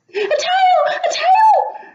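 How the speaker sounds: squealing happy possums; joyful shout. Acted. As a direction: shout with delight at the tail. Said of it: "they shouted".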